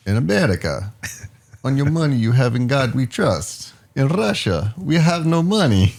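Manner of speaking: put-on comic Russian accent; Yakov. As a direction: deliver the joke as a Russian comic. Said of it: "Yakov joke".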